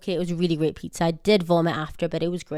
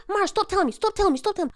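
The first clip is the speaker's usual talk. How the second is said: high, whiny husband repeating stop; husband pleading, voice pushed up high; mock pleading husband; 12 semitones higher, faster than usual. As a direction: beg her to stop, in a squeaky voice. Husband voiced begging her to stop.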